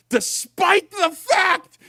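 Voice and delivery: high, strained, slowed disbelief; incredulous shout. Real, not acted. Cohost ranting about the show's alien detectors, shouting in disbelief. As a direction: yell the plot hole out.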